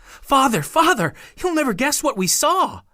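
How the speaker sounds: put-on high, panting child calling out; a young boy out of breath with news; breathless excited boy. A narrator's reading. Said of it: "Douglas panted".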